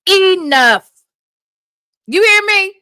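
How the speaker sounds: loud, high scolding of herself; stern self-scolding shout. Real, not acted. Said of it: "apply that last word ... to yourself".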